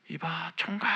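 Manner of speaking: imitated yell. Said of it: "고함을 꽥 지르면서 저를 불러요".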